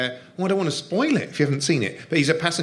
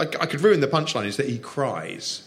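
ordinary delivery

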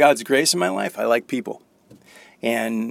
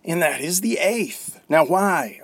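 normal talking voice

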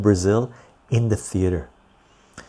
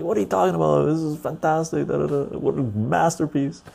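mock trash talk; 7 semitones higher than usual. Imitating own trash talk defending film.

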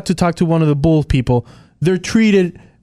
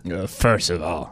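low correction; 11 semitones lower, 8 dB quieter than usual. Shown as a demonstration. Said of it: "I had to correct you 'cause you did it wrong".

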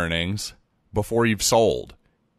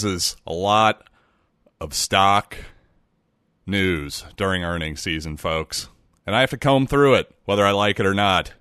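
ordinary talk, nothing marked

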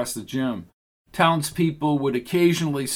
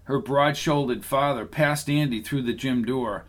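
ordinary delivery, nothing marked

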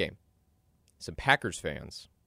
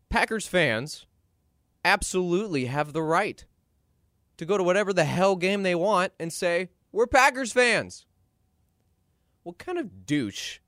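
indignant rising rant; 9 semitones higher, 6 dB louder than usual. Real, not acted. Indignant defense of fans.

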